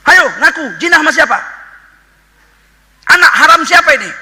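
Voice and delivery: high, loud, furious interrogation; enraged Bani Israel shouting at Mary; angry accusing crowd. Acted. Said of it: "marahnya Bani Israel".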